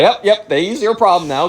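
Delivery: high, breezy brush-off; hasty bow-out. Retold or imitated. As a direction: imitate a kid eagerly excusing himself. Friend hastily bows out.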